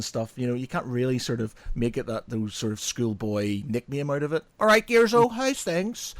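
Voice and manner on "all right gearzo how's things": high, loud matey hello; hearty mate greeting. Retold or imitated. Schoolmates greeting him by nickname, high and hearty. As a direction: call out to your mate cheerily.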